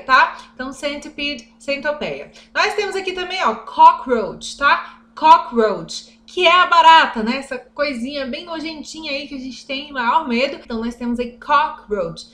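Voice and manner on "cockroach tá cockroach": slow teaching. Shown as a demonstration. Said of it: "teaching vocabulary slowly".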